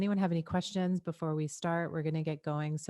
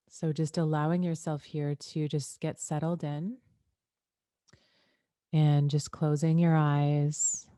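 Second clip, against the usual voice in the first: guided meditation; 5 dB louder, slower than usual. Acted. Leads guided breathing intro.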